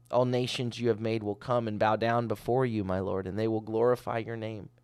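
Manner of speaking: ordinary talk, nothing marked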